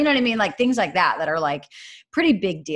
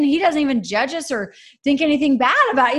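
ordinary talk

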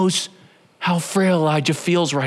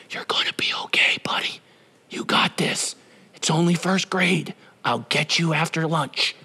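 hushed, reassuring whisper; soft, close-up parental comfort; parent's whisper; 4 dB quieter than usual. Acted. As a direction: whisper encouragement to a small child. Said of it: "How many parents know what it's like".

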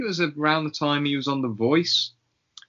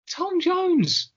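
much higher, drawn-out mock outrage at the name; mock indignant. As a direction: squeak the name in disbelief.

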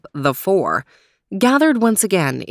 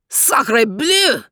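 put-on cried out high in a French accent; a startled French dog crying out; French-accented dismay, pitched up; French exclamation; 6 semitones higher than usual. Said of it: "cried Max again".

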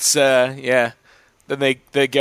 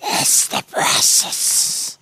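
slow, raspy unvoiced creature voice; hoarse whispering Gollum, dragging the words; Gollum impression; slower than usual. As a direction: rasp it out slowly like Gollum. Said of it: "do my Gollum impression".

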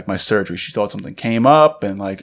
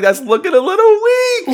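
very high-pitched put-on voice; high squeaky quote; 4 dB louder than usual. Host explaining his rookie move amid cheers, squealed. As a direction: squeal it out, far above your usual pitch.

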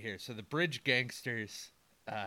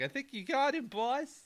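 high, squeaky sidekick voice; henchman voice; 13 semitones higher than usual. Retold or imitated.

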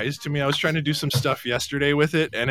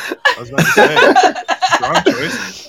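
high, breathy giggling words; cracking up mid-sentence; laughing while talking; 5 dB louder, slower than usual. Real, not acted. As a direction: squeeze the words out through laughter. Laughing at the middle name.

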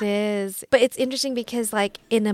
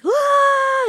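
high loud wailing cry; mock wail; 6 dB louder than usual. Women mourners at a Hmong funeral, mock wail. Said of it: "women that are wailing".

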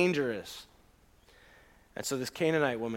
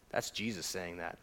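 normal talking voice

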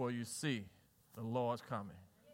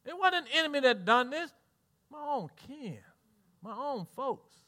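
high voice, sinking softer in hurt; acted hurt disbelief; 5 semitones higher, 4 dB quieter than usual. Parent blaming own people for the death, acted hurt. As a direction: play a wounded parent, fading on each phrase.

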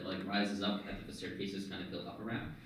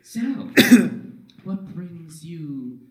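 higher, slow, stately otherworldly voice; mysterious orb voice; 5 semitones higher, 12 dB louder than usual. Acted. Orb questioning the visitor at the altar, slow eerie voice. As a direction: ask it slowly as an uncanny presence.

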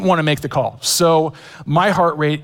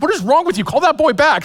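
much higher, louder mock scold from the wife; hammed-up mother-in-law telling him off; imitating an exasperated wife scolding her husband; 12 semitones higher, 4 dB louder than usual.

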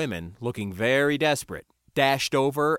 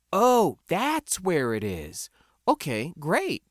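dawning realisation; 6 semitones higher, slower than usual. A narrator's reading. Narrator acts out dawning understanding.

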